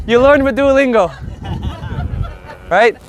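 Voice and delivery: ordinary talk, nothing marked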